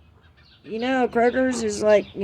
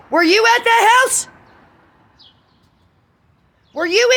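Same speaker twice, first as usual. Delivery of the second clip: furious shouting; 10 semitones higher than usual. Real, not acted. Rant escalating at her accusers.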